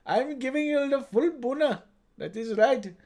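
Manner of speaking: emphatic put-on Indian accent; hammed-up Indian accent. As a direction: declare it with an Indian lilt. Indian character act.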